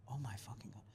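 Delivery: breathless whisper of awe; awed whisper. Retold or imitated. Comic stunned by a huge tent, awed whisper.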